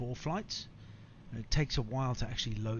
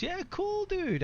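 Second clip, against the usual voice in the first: high-pitched hype; high excited; slower than usual. Real, not acted. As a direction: jump way up in pitch. Answering viewer excitedly.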